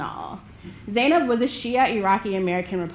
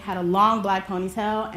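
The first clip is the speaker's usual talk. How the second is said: ordinary delivery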